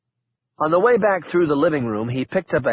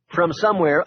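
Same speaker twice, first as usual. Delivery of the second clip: plain reading voice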